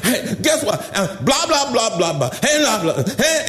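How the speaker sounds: mock angry jabber; mad ranting. Mad church member complains to preacher, mock ranting. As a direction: imitate a worked-up complainer with choppy blahs. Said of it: "they all mad and they stomp".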